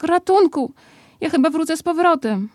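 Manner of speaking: a stuck bear calling out, half alarmed; mock cry for help. A narrator's reading. Pooh stuck in the hole, calling out in mock alarm.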